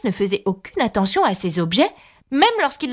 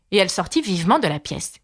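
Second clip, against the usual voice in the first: plain reading voice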